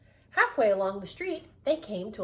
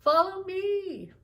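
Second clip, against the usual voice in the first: higher, louder sing-song shopkeeper; shopkeeper Missus Wise; 10 semitones higher, 6 dB louder, slower than usual. Acted. Missus Wise listing her goods to customers, high sing-song. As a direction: sell your wares like a jolly shopkeeper. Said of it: "Missus Wise said".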